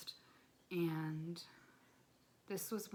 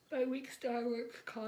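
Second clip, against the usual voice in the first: words stretched by a yawn; 7 semitones higher, 7 dB louder than usual. Real, not acted. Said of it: "I keep yawning".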